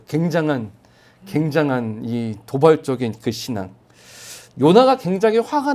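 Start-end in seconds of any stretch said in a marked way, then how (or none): none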